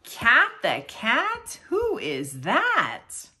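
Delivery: ordinary delivery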